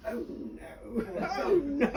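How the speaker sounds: imitating a groan through laughter, starting breathless and then going high; a laughing, groaning "oh no"; mock groan. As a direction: groan it out while cracking up. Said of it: "She would go".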